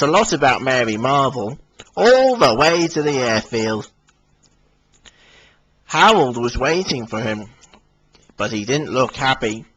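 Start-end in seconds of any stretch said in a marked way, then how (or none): none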